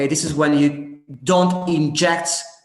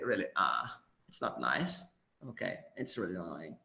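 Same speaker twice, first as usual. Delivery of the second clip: much lower, softer creaky fry; vocal fry demo; 8 semitones lower, 12 dB quieter, faster than usual. Shown as a demonstration. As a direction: drop into a creak to show it. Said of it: "don't go for vocal fry".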